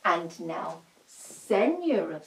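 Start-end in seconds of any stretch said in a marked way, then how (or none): none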